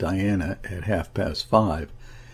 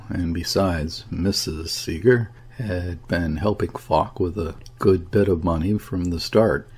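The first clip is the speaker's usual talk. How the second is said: ordinary delivery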